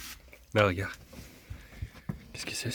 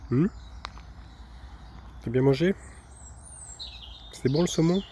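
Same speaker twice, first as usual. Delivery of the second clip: higher, sweet voice for the pet; talking to a dog; 5 semitones higher than usual. Real, not acted. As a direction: coo at the dog. (voix douce pour le chien)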